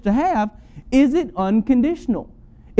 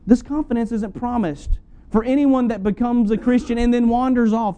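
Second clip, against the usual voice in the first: ordinary talk, nothing marked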